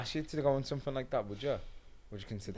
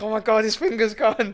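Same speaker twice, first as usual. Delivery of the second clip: mock shock; 9 semitones higher, 9 dB louder than usual. Acted shock at finger trick.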